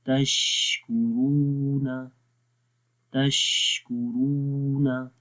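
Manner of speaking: very slow तशकुरूना, the श breathy and long; recitation demo. Shown as a demonstration. Teacher demonstrates breath in the शीन. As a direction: draw out तशकुरूना with air hissing on श. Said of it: "शीन की आवाज़ में कैसे सांस जारी हो".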